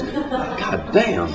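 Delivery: soft, breath-held wonder; awed oath. Retold or imitated. Hog buyer marvels at his hogs' prospects, awed. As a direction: drop to a soft, amazed oath.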